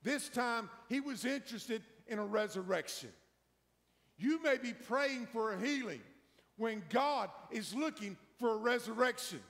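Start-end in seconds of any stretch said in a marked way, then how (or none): none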